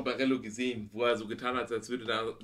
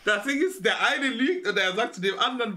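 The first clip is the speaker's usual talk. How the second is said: laughing; 8 semitones higher, 3 dB louder than usual. Real, not acted. Laughs at the clip.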